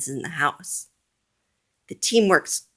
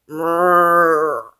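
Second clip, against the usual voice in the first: louder rumbling monster growl; 6 dB louder than usual. A narrator's reading. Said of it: "says the monster".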